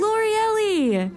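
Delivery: high, slow, drawn-out name call; calling out to her friend across the tower, delighted; excited call. Acted.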